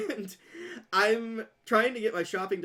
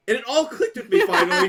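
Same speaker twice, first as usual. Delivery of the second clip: ordinary delivery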